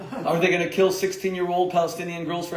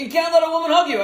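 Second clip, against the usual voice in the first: mock scold; 12 semitones higher, 8 dB louder, faster than usual. Quoting critics, mocking.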